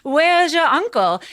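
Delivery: high, slow, mock-posh guest voice; hammed-up high party chatter. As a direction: draw it out with affected curiosity. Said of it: "the whole party's gathered around".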